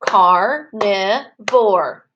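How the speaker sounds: "Carnivore" very slowly, one beat per syllable; teacher chanting "Carnivore" syllable by syllable; clapped-out syllables. Shown as a demonstration. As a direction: split "Carnivore" into three spaced chunks. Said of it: "Let's clap it together".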